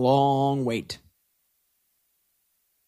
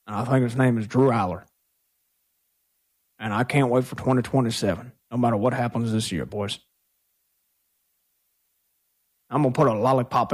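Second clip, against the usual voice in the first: low, gruff coach voice dropped well down; Mike McCarthy impression; 4 semitones lower than usual. Host voicing the coach calling the front office, low and gruff. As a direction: play the satisfied head coach reporting to the bosses. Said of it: "in my best Mike McCarthy impression".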